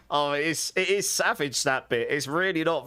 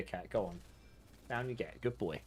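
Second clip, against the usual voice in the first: much softer, gently coaxing the cat down; soft pet talk; 6 semitones lower, 14 dB quieter than usual. Real, not acted. Streamer gently shooing his cat off the desk, soft coaxing voice. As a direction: ease him off the desk like a kind owner.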